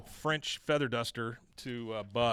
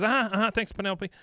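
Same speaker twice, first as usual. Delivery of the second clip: dismissive uh-huh; 7 semitones higher, 5 dB louder, faster than usual. Retold or imitated. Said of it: "He goes".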